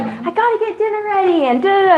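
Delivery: harried mom. Pretend. Imagined tired mom.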